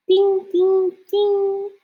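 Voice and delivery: high, ringing owl call; barred owl call. Retold or imitated. Showing kids another barred owl call, high ringing. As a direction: ring it out high like a bell-voiced owl. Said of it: "And the barred owl goes".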